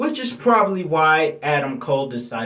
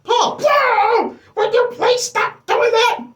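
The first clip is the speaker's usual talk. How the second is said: much higher, loud exasperated yell; mock Heyman shout; 6 dB louder than usual. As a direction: shriek it like a startled, fed-up manager. Imitating Heyman yelling at Kayla.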